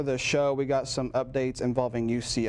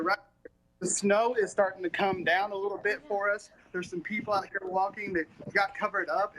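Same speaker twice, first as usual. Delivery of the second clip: much higher, bubbly live stand-up; excited snow report; 8 semitones higher than usual. Acted.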